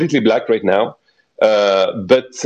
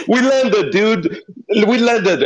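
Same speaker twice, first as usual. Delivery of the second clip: laughing; 11 semitones higher, much slower than usual. Real, not acted. Narrator laughing, announcing landing.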